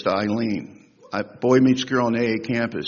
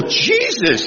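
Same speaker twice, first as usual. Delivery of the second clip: high, startled outburst; shocked exclamation; 10 semitones higher than usual. Retold or imitated. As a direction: blurt it out in disbelief. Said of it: "And Jimmy would go".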